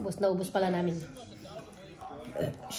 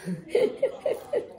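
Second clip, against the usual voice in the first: high laugh; 11 semitones higher, 4 dB quieter than usual. Real, not acted. Mother laughs at child's reply.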